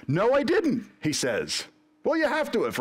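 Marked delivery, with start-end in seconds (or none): 0.1-0.9 high, emphatic acted protest; indignant denial. Job answering his friends, indignant protest. 2.0-2.8 high-pitched acted arguing; insistent retort. Job's friend arguing back, insistent.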